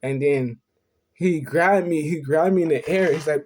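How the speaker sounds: ordinary delivery, nothing marked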